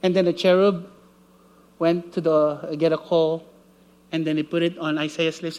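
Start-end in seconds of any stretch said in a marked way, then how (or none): none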